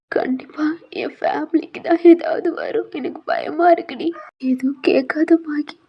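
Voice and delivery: high, tearful fearful pleading; frightened crying. Acted. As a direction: plead through tears, scared. Heroine fears for her family. (பயத்துடன் அழுது கெஞ்சல்)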